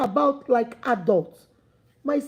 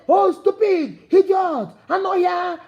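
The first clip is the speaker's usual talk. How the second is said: raised, high mock shout of insults; aggressive name-calling; 5 semitones higher, 4 dB louder than usual. Coach imitating a hostile partner calling names, aggressive shout. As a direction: hurl each insult loudly, acting hostile. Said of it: "how aggressive you are".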